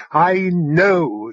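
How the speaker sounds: a quick, exasperated narrated yell. A narrator's reading. Alva to the drone in her way, yelling. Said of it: "she yelled".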